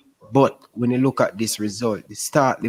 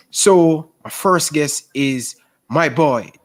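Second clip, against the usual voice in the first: ordinary talk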